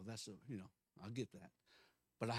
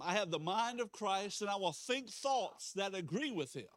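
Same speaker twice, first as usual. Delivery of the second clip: higher, measured congregational confession; declaration of faith; 8 semitones higher, 4 dB louder than usual. Real, not acted. Pastor having church repeat it once more, firm declaration. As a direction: lead them through it with conviction.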